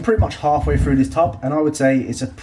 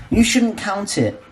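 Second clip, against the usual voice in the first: mock critic; 9 semitones higher, slower than usual. Voicing an imagined critic.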